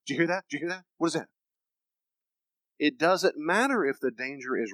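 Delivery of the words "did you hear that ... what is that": high, quick startled questions; alarmed pets. Acted. Dogs and cats hearing a noise, alarmed. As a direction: voice a jumpy dog on alert. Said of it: "It's my dogs and cats going".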